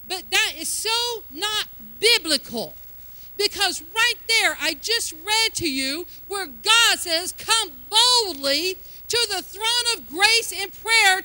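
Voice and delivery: emphatic, rising pulpit fervor; forceful preaching. Real, not acted. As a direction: drive the point home to the congregation. Preacher corrects the saying forcefully.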